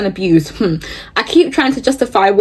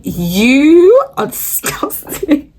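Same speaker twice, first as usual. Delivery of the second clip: laughing scorn; 5 semitones higher, much slower than usual. Real, not acted. Scolding him, laughing.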